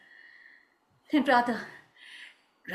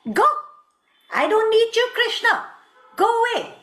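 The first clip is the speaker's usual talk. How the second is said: high, loud, scornful lover's rebuke; hurt, jealous reproach; 6 semitones higher, 3 dB louder than usual. Acted. Jealous Radha sends Krishna away, wounded and angry. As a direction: throw the accusations at him, pitch soaring.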